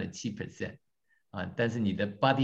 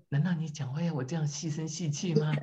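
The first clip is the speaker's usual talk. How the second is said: higher, thin, overly delicate voice; mock dainty voice; 6 semitones higher, 3 dB quieter than usual. Shown as a demonstration. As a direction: put on a prissy little voice to mock the idea.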